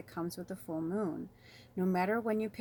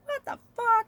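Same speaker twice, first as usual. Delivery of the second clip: high, loud mock curse; past angry self; 9 dB louder than usual. Host imitating how she used to curse, high mock yell. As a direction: yelp it like the old hothead. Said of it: "I used to be like".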